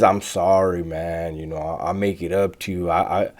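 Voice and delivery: low, softly muttered mock apology; behind-the-scenes apology. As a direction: quote it quietly, as if privately placating. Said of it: "all that behind the scenes".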